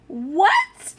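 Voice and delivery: high, loud, rising "What?"; the mother cries out in disbelief; startled mother cries out; shocked cry. A narrator's reading. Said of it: "cried the boy's mother".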